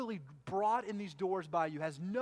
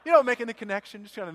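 louder and higher, half laughing; loud, laughing cover-up; 5 semitones higher, 14 dB louder, faster than usual. Real, not acted. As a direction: brush off the joke out loud.

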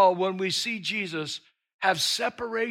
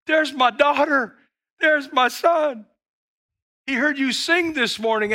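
higher and louder, a beaming dad calling from the sideline; proud cheering parent; 8 semitones higher, 6 dB louder than usual. Acted. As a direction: cheer them on like a proud father.

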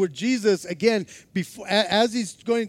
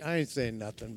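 mock humble mumble; 10 semitones lower, 8 dB quieter than usual. Imagined humble Peter, murmured.

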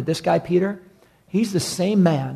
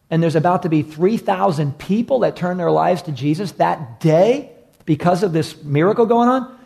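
normal talking voice

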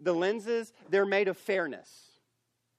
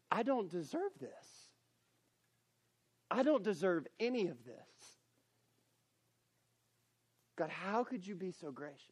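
humbled gratitude; 7 dB quieter than usual. Shown as a demonstration. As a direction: say I don't deserve this in awed gratitude. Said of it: "you're saying it like this".

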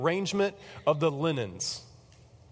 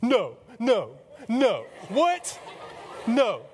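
acted squealing shock, word by word; going ballistic; 7 semitones higher, much slower than usual. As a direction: play someone freaking out in amazement. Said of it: "she's just going ballistic".

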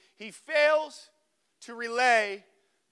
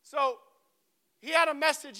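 ordinary talk

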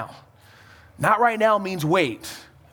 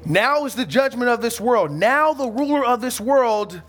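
preaching scripture; 5 semitones higher than usual. Acted. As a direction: declaim it with weight. Reading Jesus' words aloud.

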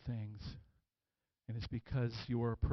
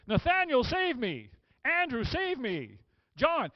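high, loud calls to friends; calling for help; 13 semitones higher, 8 dB louder, slower than usual. Acted. Peter hypothetically begging companions, urgent calling out. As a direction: call each name out as if sinking.

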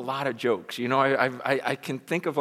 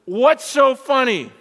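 loud, higher demand in a gangster's voice; angry gangster yelling at the laughing man; 10 semitones higher, 9 dB louder, slower than usual. Retold or imitated. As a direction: bark it at him, slow and threatening. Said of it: "he goes".

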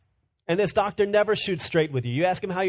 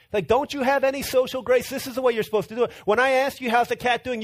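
mock lecturing; 6 semitones higher than usual. Brother lecturing on tact.

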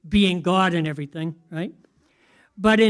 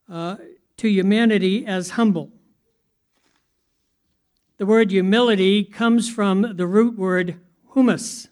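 usual speaking voice

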